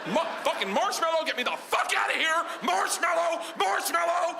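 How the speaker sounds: very high frantic shouting; panicked cry for help. Retold or imitated. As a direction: scream the safe word to be rescued. Panicked safe-word cries for help.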